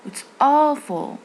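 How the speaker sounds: It's awful very slow, high, loud, falling; rise and fall. Shown as a demonstration. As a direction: stretch awful up high then let it fall. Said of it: "طلع ونزل برضو". (طلوع ونزول في awful)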